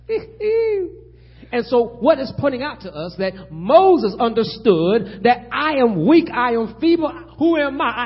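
loud, high, stretched pulpit cadence; preaching at full force. Real, not acted. Preacher proclaiming God's promise to fearful Moses, full-force preaching. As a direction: build to a climax, drawing out the last words.

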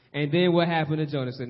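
ordinary talk

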